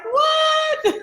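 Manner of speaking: high, laughing yelp of disbelief; mock surprise. Host relays the startled thought about Naked Party, mock surprise. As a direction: squeal it like a playful double take.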